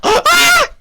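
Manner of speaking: very high loud shriek; real scared scream; startled scream. Real, not acted. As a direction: scream in fright. Another jump scare.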